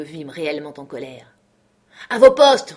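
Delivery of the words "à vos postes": much louder and higher roar of an order; angry captain roaring at his men to take their posts. A narrator's reading. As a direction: bellow the command across the house. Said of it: "rugit-il".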